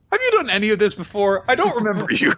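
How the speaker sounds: high-pitched mock confusion; baffled high voice. Admitting she forgot the character, high baffled voice. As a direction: squeak it out, clueless.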